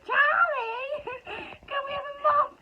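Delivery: loud laughing call; laughing shout. Retold or imitated. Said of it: "I was like".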